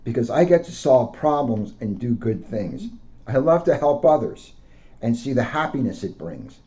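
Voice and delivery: gentle storybook read-aloud; a police officer reading to children. Acted. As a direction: read it out to kids like a picture book. Officer reads picture book aloud.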